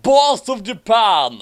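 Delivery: loud proclamation. Acted. Reading the medal.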